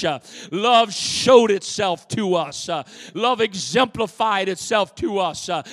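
loud, rising sermon cadence; preaching at full force. Acted. Pastor preaching loud to his congregation. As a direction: preach it out big to the church.

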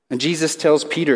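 usual speaking voice

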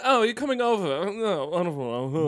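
put-on high, loud transatlantic accent; a glamorous old Hollywood star in a transatlantic accent. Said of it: "the transatlantic accent".

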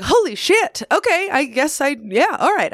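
high, startled disbelief; stunned surprise. Retold or imitated. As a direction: gasp it out in disbelief. Retold shock at winning.

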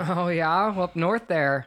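mock Minnesotan "Oh, yeah?"; Minnesota accent. Guest playing along with the bit, Minnesota accent. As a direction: round the vowels like a northwoods local. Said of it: "where did you get that accent from".

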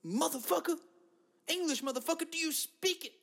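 high-pitched, held-back mock yell; Sam Jackson. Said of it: "I gotta give it to Sam Jackson".

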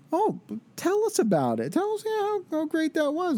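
high, mocking sing-song sneer; French rivals sarcastically gloating over the cardinals' blunder; sarcastic mockery. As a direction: jeer at them in a sarcastic falsetto.

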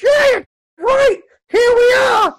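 very high squealed sidekick voice; tiny creature piping up excitedly; Creechy. Acted. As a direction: squeal it out far above your natural pitch. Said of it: "And Creechy goes".

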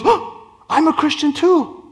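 high-pitched imitation of an eager woman; excited believer woman. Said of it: "And she goes".

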